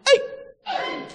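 ordinary talk, nothing marked

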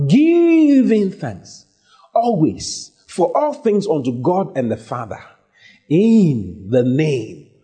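drawn-out, soaring opening words; scripture proclamation. Acted. Preacher reads the verse to the congregation, slow ringing proclamation. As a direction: proclaim it slowly, stretching key words.

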